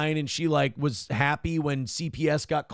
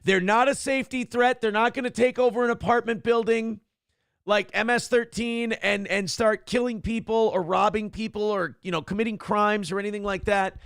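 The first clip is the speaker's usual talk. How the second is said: gleeful yes; 5 semitones higher than usual. Retold or imitated. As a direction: shout your enthusiastic agreement. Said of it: "And I'm like".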